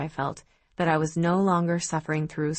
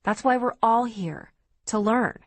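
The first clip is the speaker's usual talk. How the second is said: raised-pitch self-justification; defensive, higher and emphatic; 5 semitones higher, 3 dB louder than usual. Acted. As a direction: insist on it, defending yourself. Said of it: "I said defensively".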